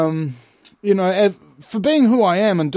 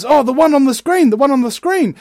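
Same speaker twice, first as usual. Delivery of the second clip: eager explaining; 8 semitones higher, 4 dB louder than usual. Retold or imitated. Man pointing aliens to TV.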